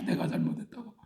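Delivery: wife whispering her apology while crying; sobbing whisper. Retold or imitated. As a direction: breathe the apology out through tears. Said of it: "질질 짜고 울고".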